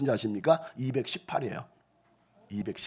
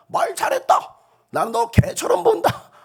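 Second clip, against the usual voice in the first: much higher, shouted scorn; giant's roaring taunt; 6 dB louder than usual. Acted. Goliath shouting insults at David, loud roar. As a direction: roar it down at the boy.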